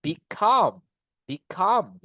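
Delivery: "Become" repeated, each "come" punched and falling; stress on second syllable. Shown as a demonstration. Teacher drilling the class, stress on "come" in "Become". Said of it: "Ada penekanannya kan?".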